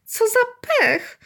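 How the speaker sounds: high, drawn-out indignant whine; hammed-up upset boy; dismayed. A narrator's reading. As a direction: wail in exasperated disappointment. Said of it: "Guciowi wcale się to wszystko nie podobało".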